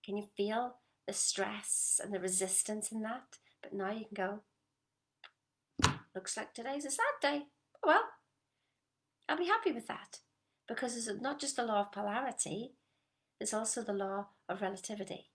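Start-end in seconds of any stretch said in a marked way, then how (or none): 6.1-10.2 high, loud, breezy acceptance; cheerful shrug. Pretend. Coach voicing a carefree self, bright and loud. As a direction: toss off the sad day brightly.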